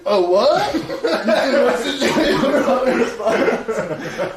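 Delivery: loud, high mock roar through laughter; hammed-up caveman outrage, breaking into laughs; riled-up caveman. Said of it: "full caveman".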